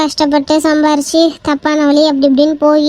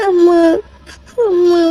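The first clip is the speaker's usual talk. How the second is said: tearful cry of அம்மா; crying to mother; slower than usual. Acted. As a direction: call out to mother through tears. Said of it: "என்னடி இப்படி அழுதுட்டு வந்து நிக்கிற".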